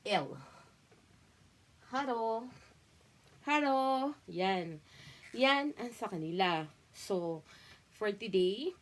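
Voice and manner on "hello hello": Hello said as haro, the L swapped for a Japanese r; haro without L. Retold or imitated. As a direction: mimic a Japanese child's haro, no L sound. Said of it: "wala po silang L".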